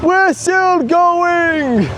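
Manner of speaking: slow, stretched-out happy exclaim; delighted. Real, not acted. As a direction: drawl it out, thrilled. Ride keeps spinning, parent delighted.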